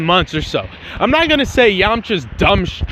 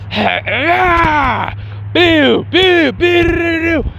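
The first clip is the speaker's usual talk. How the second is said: angry battle yell; 9 semitones higher, much slower than usual. Retold or imitated. Angry boy beating up the android, yelling hit noises. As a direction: yell each hit like an enraged fighter. Gohan gets angry, attacking.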